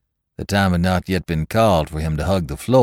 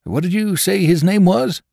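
high, louder nervous question; anxious drummer; 14 semitones higher, 5 dB louder than usual. Acted. Drummer asks the men, high and nervous. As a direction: ask it with growing unease. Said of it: "he asked".

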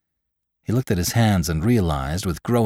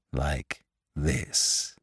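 low, much softer demonstration; quiet menace; 5 semitones lower, 9 dB quieter than usual. Acted. Lantry to the numb man as he strikes, quietly menacing. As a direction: say it softly, pitch dropped.

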